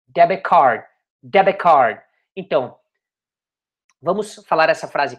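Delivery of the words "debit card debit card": "debit card" with no T released before "card"; silent T. Shown as a demonstration. Teacher drilling the phrase for learners, "debit card" with a silent T. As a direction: run "debit card" together as "deh-beh card". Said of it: "Você não pronuncia o T".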